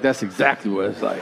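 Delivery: put-on low, matter-of-fact older kid voice; a lightly voiced older kid, dead sure. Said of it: "And he was like".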